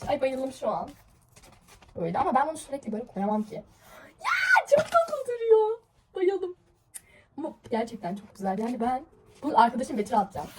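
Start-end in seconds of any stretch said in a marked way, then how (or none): 4.2-6.6 very high, slow, loud cooing squeal; gushing squeal. Real, not acted. Showing viewers the bookmark in her book, gushing in a high squeal. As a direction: melt over how cute it is.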